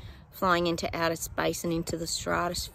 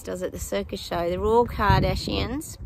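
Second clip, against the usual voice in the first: ordinary talk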